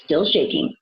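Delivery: ordinary delivery, nothing marked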